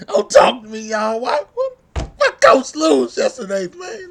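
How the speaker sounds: exasperated outburst. Real, not acted. Fan furious over team's loss.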